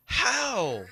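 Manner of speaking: high, loud incredulous "how"; hammed-up stunned question; mock disbelief. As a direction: ask it in amazed disbelief. Own disbelief voiced.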